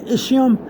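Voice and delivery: ischium said with a soft sh; soft-sh pronunciation. Shown as a demonstration. Teacher showing learners two ways to pronounce it, soft-sh version. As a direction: say ischium as ISH-ee-um. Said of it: "there's two ways to pronounce this".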